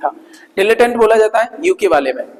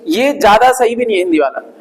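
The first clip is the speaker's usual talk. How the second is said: ordinary delivery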